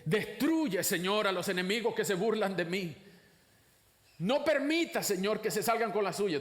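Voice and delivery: high, forceful plea to God; fervent psalmist's cry, punched at each opening word; imprecatory prayer. Acted. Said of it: "oraciones imprecatorias vienen de los salmos".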